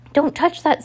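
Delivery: high urgent cry of warning. Acted. Violet crying out to Sunny to drop it. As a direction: shout it to stop the baby. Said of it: "Violet cried".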